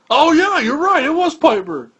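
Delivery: high, loud mock realization; Vince. Boss admitting the commentator is right, loud high imitation. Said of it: "And he goes".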